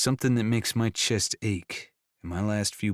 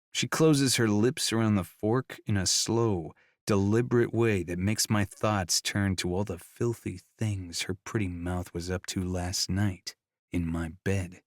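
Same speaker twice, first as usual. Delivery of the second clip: plain reading voice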